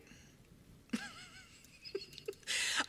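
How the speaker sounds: real drawn-out laugh. Real, not acted. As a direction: laugh at your own silly quote. Amused at her own quote.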